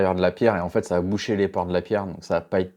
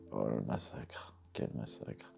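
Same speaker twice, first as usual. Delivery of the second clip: much softer, breathed-out whisper; whispered dismay; 11 semitones lower, 18 dB quieter than usual. Real, not acted. Watching a knife get ruined, whispering in dismay. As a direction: murmur it as if appalled.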